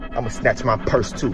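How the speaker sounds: ordinary talk, nothing marked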